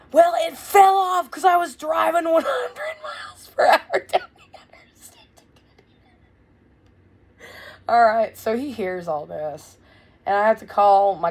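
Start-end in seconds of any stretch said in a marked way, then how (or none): 0.1-7.5 high, tearful whining wail; crying whine. Retold or imitated. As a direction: wail the excuse through sobs. Mother's crying excuse voiced.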